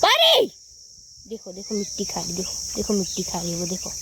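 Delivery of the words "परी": high, piercing call of the dog's name; sharp call. Real, not acted. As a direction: call Pari back at once. Calling the dog sharply.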